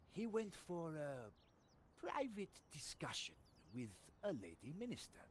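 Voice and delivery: put-on storytelling French sergeant accent; a French soldier confessing; lightly held French accent. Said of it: "you just get a French accent".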